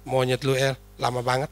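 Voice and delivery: low, hushed imitation of a roommate; very soft voice. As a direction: insult delivered in a gentle, soft tone. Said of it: "dia lembut sekali dia bicara". (suara lembut sekali, pelan)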